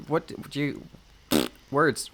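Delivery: ordinary delivery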